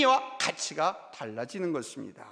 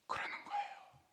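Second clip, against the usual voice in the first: whisper; 17 dB quieter than usual. Real, not acted. Whispered aside.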